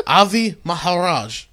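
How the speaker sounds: put-on name said in a Pakistani accent. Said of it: "you know how to do a goddamn Pakistani accent".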